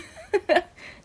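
quick high laugh; a brief amused laugh; short laugh. Real, not acted. Laughs at own speaking.

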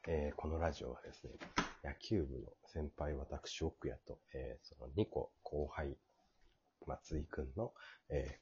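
hushed, slow radio intro; quiet at night. Real, not acted. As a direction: keep it low, late at night. Still hushed, family asleep.

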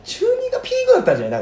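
high, fast joking squeak. Real, not acted. Joking peak at age 14.

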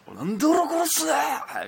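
high, loud mock rage; angry outburst. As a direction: snap like a furious friend. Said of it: "ブチギレるやつがおって". (怒鳴るような再現)

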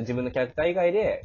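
ordinary talk

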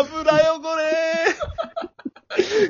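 high, long-drawn echo of a yell; hammed-up sustained high cry; echoed mock shout. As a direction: call it out high and stretched. Echoing the shouted catchphrase.

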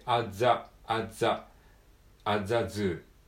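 "アザ" said with no t between at and the; dropped t. Shown as a demonstration. Teacher drills "アザズー" with the t dropped. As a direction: link "アザズー" without the t. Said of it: "アットザじゃなくて".

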